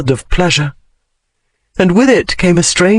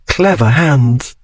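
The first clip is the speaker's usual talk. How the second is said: usual speaking voice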